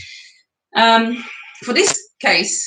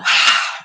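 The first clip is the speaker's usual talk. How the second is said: long audible breath out; breathy exhale sigh. Shown as a demonstration. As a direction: let the air out in a sigh. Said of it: "And out".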